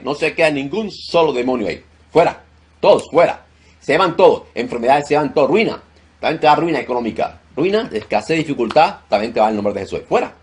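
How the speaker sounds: loud, high, commanding rebuke of demons; fiery deliverance preaching. Acted. Pastor commanding demons to leave the congregation, shouted. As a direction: cast the demons out at full force.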